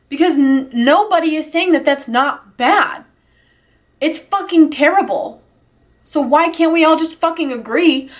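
blurting out real frustration at people who won't agree; exasperated outburst. Real, not acted. To her viewers, frustrated that people won't agree, high exasperated outburst. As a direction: voice pushed high, frustrated and pleading. Heated rant about racist posts.

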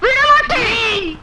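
drawn-out, high refusal; defiant cry. Acted. Wife tells husband she will not let go, drawn-out defiant cry. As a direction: stretch it into a defiant wail.

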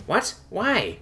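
high-pitched, light female voice; startled high questioning; puzzled female partner. A narrator's reading. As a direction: ask back, high and confused. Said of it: "she asked from a mental connection".

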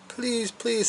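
put-on high-pitched begging kid voice; acted storybook pleading; pleading boy. A narrator's reading. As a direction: plead high, as an embarrassed child. Andrew begs his mum in story.

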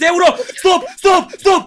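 building to high, loud yelps; excited yelling. Real, not acted. Streamer watches the case spin, rising excited yells.

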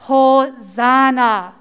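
measured, drawn-out teaching delivery; slow model word. Shown as a demonstration. Teacher showing children the special word, slow and measured. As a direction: say it slowly and evenly, drawn out. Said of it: "I'm gonna say a word".

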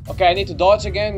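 ordinary talk

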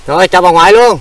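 loud, high greeting to grandma; calling out. Real, not acted. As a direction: raise your voice cheerily to an elder.

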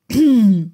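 loud, pointed throat clearing. Acted. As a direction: clear your throat to get noticed. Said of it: "räusperte sich Draco neben ihm".

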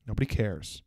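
low, hushed deadpan aside; quiet dismissal. Acted. Answering the flight attendant's card pitch, quietly dismissive. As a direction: drop right down, soft and dismissive. Muttered dismissal ending the rant.

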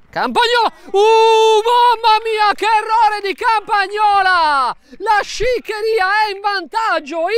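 high, loud, stunned shout; breathless italian goal commentary. Real, not acted. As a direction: yell the blunder in disbelief. Goalkeeper blunder gives away goal.

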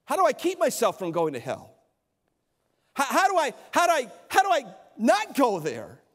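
voicing a listener who desperately asks how to escape hell; mock pleading questioner. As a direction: climb into an urgent, pleading "how". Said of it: "So if somebody says".

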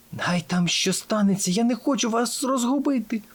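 high, airy stage whisper; urgent whisper. A narrator's reading. Brioche tying her friends to herself, whispering. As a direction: whisper it, lifting high at the end. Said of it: "прошепотіла вона".

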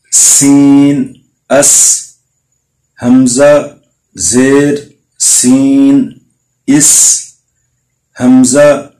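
slow chanted letter-by-letter drill, each اط and از bounced; tajweed spelling drill. Shown as a demonstration. Teacher drilling students in reciting qalqalah letters.

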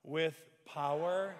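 power stretched out slowly; slow emphasis. Shown as a demonstration. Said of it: "Wow, po".

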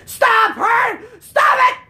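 high, loud screamed plea; exasperated gamer screaming; frantic protest. Real, not acted. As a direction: shriek at the boss to quit. Being hit by boss.

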